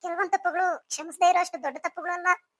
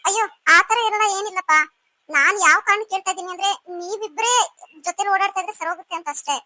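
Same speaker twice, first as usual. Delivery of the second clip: louder, defensive denial; loud protest; 2 semitones higher, 6 dB louder than usual. Acted. Sister denies being bothered, louder and defensive. Protests she is not irritated.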